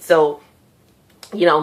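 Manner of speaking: normal talking voice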